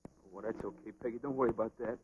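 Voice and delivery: low, hushed, soothing voice; gentle reassurance. Acted. Boxer forgiving his old girlfriend, soft low reassurance. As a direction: softly put her at ease.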